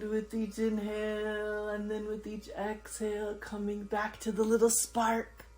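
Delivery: very slow, stretched words with long pauses; calm, sustained yoga nidra voice; guided breathing meditation. Acted. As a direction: draw out each inhale and exhale cue. Leads a counted breathing meditation.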